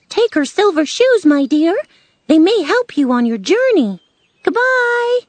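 high, loud little-person voice; cheery Munchkin. Acted. Munchkin sends Dorothy on her journey, cheery. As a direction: send her off loudly, squeal the farewell.

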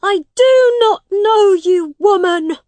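high, loud angry denial; forceful disowning yell; shouted. A narrator's reading. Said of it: "Tom shouted at his mother".

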